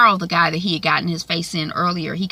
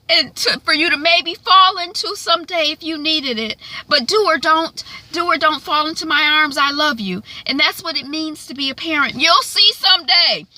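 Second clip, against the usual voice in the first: a touch of heartfelt mom; earnest tender monologue, pitched up; dramatic mother speech; 7 semitones higher than usual. Acted. Mother telling teen daughter she'll keep arms open, dramatic. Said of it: "be dramatic at the same time".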